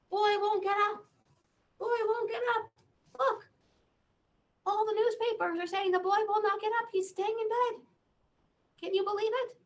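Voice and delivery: high, excited townspeople voice; townsfolk exclaiming. Acted. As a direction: squeal it like gossiping townsfolk. Townsfolk voice in the story.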